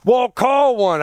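high, sharp mock wife; mock wife snap. Husband voices his wife snapping back in a high mock voice. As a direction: toss it back like a fed-up wife. Wife's retort in retold argument.

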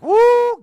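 loud, high-pitched held "woo"; excited whoop. Acted. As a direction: whoop eagerly like a thrilled prophet. Imagined old prophets' eager reaction.

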